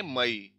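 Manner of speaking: मई in two syllables, stress on the ई; stress on final ई. Shown as a demonstration. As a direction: say मई as ma-EE, weight on ई. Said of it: "लेकिन stress किस पे है? ई पे।". (मई में दूसरे स्वर ई पर ज़ोर)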